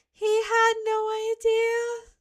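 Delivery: high, slow, mocking "He had no idea". Shown as a demonstration. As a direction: squeak "He had no idea" out slowly in a high teasing voice.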